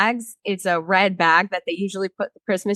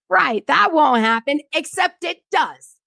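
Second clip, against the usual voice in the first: higher-pitched, exasperated sarcasm; mock agreement turning to exasperated insistence; sarcastic rebuttal; 6 semitones higher than usual. Retelling her answer to the lawyer, sarcastic rebuttal.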